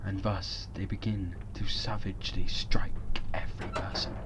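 soft, breathy storyteller whisper; spooky whisper. Pretend. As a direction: whisper it like a creepy tale. Spooky narration of invented plot.

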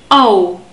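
au as one gliding sound from A into u; diphthong au. Shown as a demonstration. Teacher shows how A and u join, diphthong au. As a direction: glide from A to u in au. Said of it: "A and u becomes".

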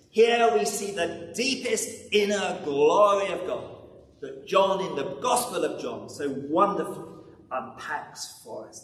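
normal talking voice

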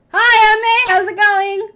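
overly cheerful high hello; rehearsed greeting. Pretend. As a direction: practise a peppy hello in the mirror. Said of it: "what are you gonna say?".

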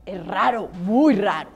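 plain reading voice

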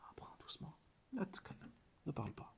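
soft unvoiced whisper; whispered advice. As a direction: hush it like a secret. Hushed advice repeated. (conseil murmuré)

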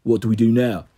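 low Cockney thug reporting in; deep Cockney growl. Acted. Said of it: "a deep growl with a strong Cockney accent".

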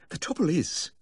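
high, gasped-out confession; distressed gasp. A narrator's reading. Upset dog owner confessing to the vet, distressed gasp. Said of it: "he gasped".

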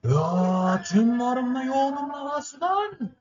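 high, very slow dragged-out wail; stretched exasperated cry. Real, not acted. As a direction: drag each word out in despair. Long exasperated cry at game.

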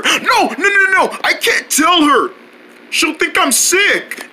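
high, pleading protest; panicked refusal. Acted. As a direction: plead against telling mother. Panics about telling mother.

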